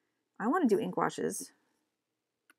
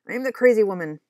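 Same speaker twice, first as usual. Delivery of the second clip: proud loud declaration; 2 semitones higher, 11 dB louder, faster than usual. Real, not acted. Owning her quirks to her partner, loud and proud. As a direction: boom it out, proudly owning the label. Cheerfully owning being weird.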